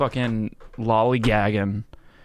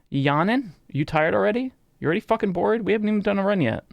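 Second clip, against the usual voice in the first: high, playful scold at a yawning pet; fake-angry telling off the dog, pitched way up; mock scolding the pet; 9 semitones higher, faster than usual. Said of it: "I'm sorry I yelled at you".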